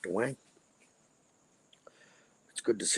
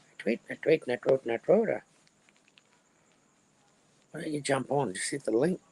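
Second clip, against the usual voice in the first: a hint of the squeaky Irish drunk, higher; lighter squeaky Irish voice; 7 semitones higher than usual. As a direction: lightly keep the squeaky mock voice. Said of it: "your little squeaky Irish voice".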